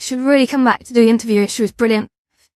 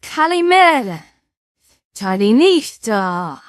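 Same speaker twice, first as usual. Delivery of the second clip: put-on Greek accent; much slower than usual. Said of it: "you have to talk like a Greek philosopher".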